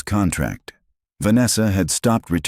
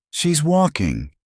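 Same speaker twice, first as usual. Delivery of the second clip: stunned; 12 semitones higher, slower than usual. Acted. Crowd member gasps in disbelief.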